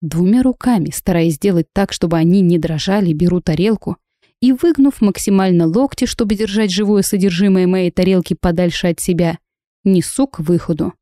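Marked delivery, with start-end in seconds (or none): none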